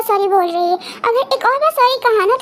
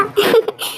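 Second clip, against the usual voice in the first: laugh. Real, not acted. Friends joking about babysitting.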